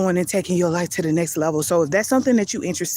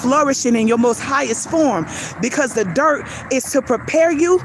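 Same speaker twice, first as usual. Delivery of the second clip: preaching; 3 semitones higher than usual. Acted. Builds into preaching.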